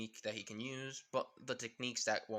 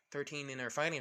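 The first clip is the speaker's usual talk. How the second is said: ordinary delivery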